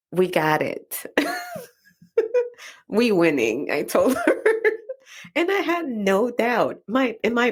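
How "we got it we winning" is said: put-on mild Dominican accent, laughing through it; lightly voiced Dominican accent, sure of the win; big Dominican accent. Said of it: "I told her in my big accent".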